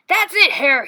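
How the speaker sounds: loud angry bear holler; furious bear shouting at Hare. A narrator's reading. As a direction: roar that the deal is off. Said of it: "he hollered".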